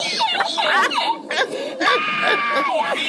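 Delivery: long high squealing cackle; shrieking laugh. Real, not acted. Storyteller cracking up over the melted pump, shrieking laugh. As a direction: lose it in high, helpless laughter.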